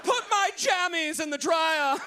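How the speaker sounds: high, prissy royal whine; spoiled prince. Pretend. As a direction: play a pampered little prince. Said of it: "You sound like a spoiled prince".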